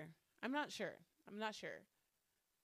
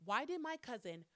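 usual speaking voice

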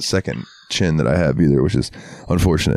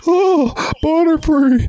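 much higher, slow wail; mock mourning cry; slower than usual. Quoting Hank's grief, high slow wail. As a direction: wail over the fallen Pokemon. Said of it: "he goes".

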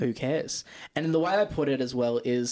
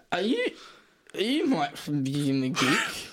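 put-on drunk stranger; 4 semitones higher, slower than usual. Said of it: "A dude looks over at me and goes".

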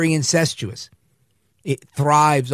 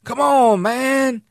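hammed-up whiny "Come on, man"; Biden whining; 8 semitones higher, much slower than usual. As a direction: whine it like a mocked old politician. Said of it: "a little bit of whining".

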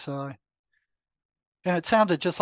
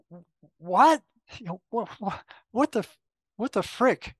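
startled; 4 semitones higher than usual. Retold or imitated. Startled by the voice underwater.

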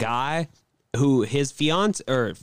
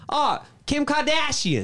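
high, put-on Boston accent; high mock-Boston voice; Boston wife; 11 semitones higher than usual. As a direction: mimic a Boston woman with dropped R's. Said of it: "is from Boston".

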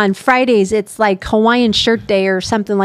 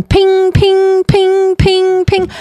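imitating phone ping; 7 semitones higher, much slower than usual. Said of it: "my phone was just pinging".

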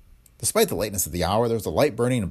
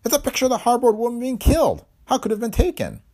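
shaken; 5 dB louder than usual. A narrator's reading. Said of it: "Then he uttered a tremulous oath".

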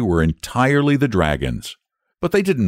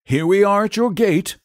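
storyteller man; 12 semitones higher than usual. A narrator's reading. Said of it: "abruptly laying his hands".

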